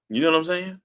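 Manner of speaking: ordinary talk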